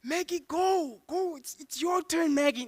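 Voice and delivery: high-pitched, frantic prodding; hammed-up high panicky voice; panicked urging. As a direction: urge a hesitant driver on in a panic. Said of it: "We're panicking at the robots".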